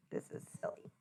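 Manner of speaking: giggled under the breath; a giggly hush; laughing whisper. Real, not acted. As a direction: laugh it quietly.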